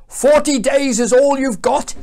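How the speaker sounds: high, declamatory proclamation; acted prophet's stern call; loud warning. A narrator's reading. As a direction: warn a whole city. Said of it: "he warned them".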